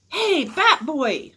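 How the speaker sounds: high, drawn-out yell from the stands; loud, slow holler; kid yelling. A narrator's reading. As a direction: yell to get the bat boy's attention. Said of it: "a kid yelled from the stands".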